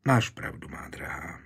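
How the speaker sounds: soft, low, sighed concession; sighing. A narrator's reading. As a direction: let it sink away on a sigh. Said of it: "povzdechl si".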